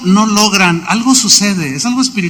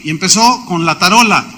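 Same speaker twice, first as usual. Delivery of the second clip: ordinary delivery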